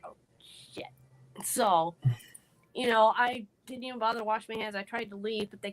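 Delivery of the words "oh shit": breathless unvoiced whisper of dismay; whispered curse. Trapped girl whispering to herself on seeing bullies. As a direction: barely breathe the words out in shock. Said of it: "and I was like".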